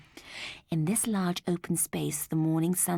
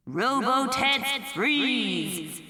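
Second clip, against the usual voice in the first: slow, louder loudspeaker order; booming command; 7 semitones higher, 4 dB louder, much slower than usual. Acted. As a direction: boom it down over the crowd. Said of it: "boomed the giant's voice".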